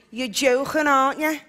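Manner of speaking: Liverpool lilt, "joking" with a throaty k; Scouse, rasp the k in "joking"; Scouse accent. Shown as a demonstration.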